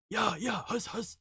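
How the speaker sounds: normal talking voice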